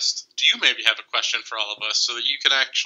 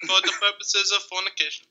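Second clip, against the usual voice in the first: Mike Tyson impression; 7 semitones higher than usual. Friends trading versions of the fornication quote, mock boxer voice. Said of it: "your Tyson impression".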